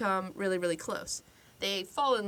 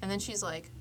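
ordinary talk

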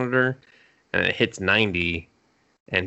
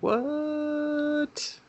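high, soft, drawn-out squeak; mock stunned disbelief; 6 dB quieter than usual. Boy hearing she is a rent-a-girlfriend, mock stunned. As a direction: stretch it out high, imitating shock. Retold stunned reaction to her revelation.

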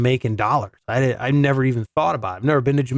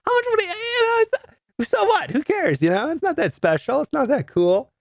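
much higher, dismissive rationalizing that eases back down; mock self-convincing shrug; 11 semitones higher than usual. Reasoning with himself about a rich man's money, high and dismissive. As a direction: talk yourself into not caring. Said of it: "I'm, like, rationalizing it in my head".

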